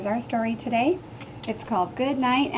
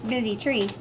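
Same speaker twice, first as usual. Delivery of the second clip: ordinary delivery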